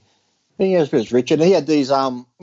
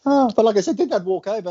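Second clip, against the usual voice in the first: excited burst; 8 semitones higher than usual. Real, not acted. Excited restart of story.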